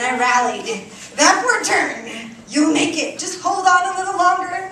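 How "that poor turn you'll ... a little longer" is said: pleading with myself. Retold or imitated. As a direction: beg yourself loudly not to give up. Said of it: "I pleaded on with, with myself".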